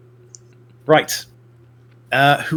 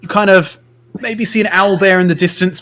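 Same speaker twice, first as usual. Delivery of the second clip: normal talking voice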